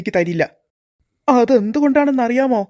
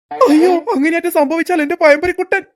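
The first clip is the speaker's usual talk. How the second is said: panicked wail; 5 semitones higher than usual. Acted. Guard panicking that the kids will take his fritter, rising into a wail. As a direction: wail in mock despair for your snack.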